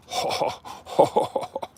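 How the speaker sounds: voiceless, breathy ho-ho-ho laugh; breathy laughter. Real, not acted. Driver sharing a beautiful moment, breathy laughter. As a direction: laugh on pure breath in bursts.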